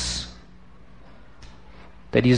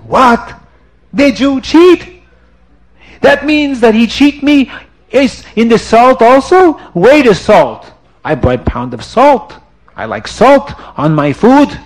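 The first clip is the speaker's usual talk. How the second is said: high, loud disbelieving cries; a big man realizing he's been cheated; shocked outrage; 8 semitones higher, 5 dB louder than usual. Acted. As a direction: sputter with sudden suspicion. Salim realizes he was cheated.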